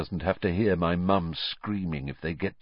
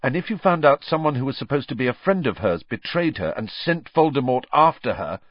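rising anguish; 6 semitones higher, 4 dB louder than usual. A narrator's reading. Harry telling friends about his mum's screams, rising anguish. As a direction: voice climbing higher and louder, urgent.

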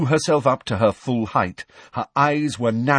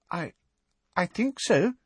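high, hesitant, shaky reply; falter, small and pitched up; 10 semitones higher than usual. A narrator's reading. Girl just coming round answers George, shakily. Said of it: "she said shakily".

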